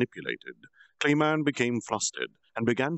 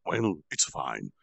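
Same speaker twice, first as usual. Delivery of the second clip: plain reading voice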